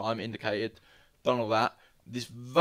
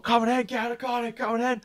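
fast, higher taunting shout; mock aggressive challenge; 11 semitones higher, faster than usual. Retelling the van driver goading him out of the car, fast and high. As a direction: goad him to get out, fast.

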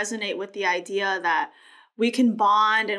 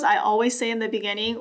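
normal talking voice

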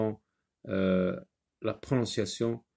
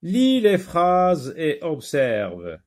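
instruction read aloud; 5 semitones higher, 5 dB louder, slower than usual. Shown as a demonstration. Reads exercise instruction aloud.

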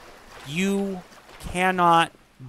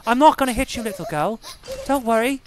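higher-pitched, hammed-up fake British; bad British accent; 5 semitones higher than usual. Shown as a demonstration. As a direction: put on a lousy British accent. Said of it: "My British accent's just fucking shit".

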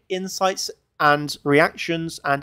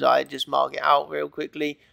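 normal talking voice

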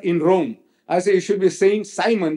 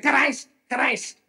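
calling out; 8 semitones higher than usual. Pretend. Preacher imitates people hailing him by title, calling out. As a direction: call the name high as if hailing someone. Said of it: "He don't know what you're shouting".

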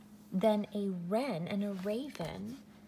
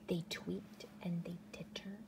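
hushed whisper; 11 dB quieter than usual. A narrator's reading. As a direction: whisper it, no voice at all. Reading softly, hushed.

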